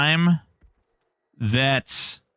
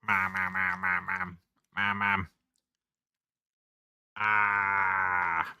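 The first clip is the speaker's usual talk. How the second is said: whiny cat calling mom; 6 semitones lower, 9 dB quieter, slower than usual. Pretend.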